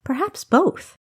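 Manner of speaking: plain reading voice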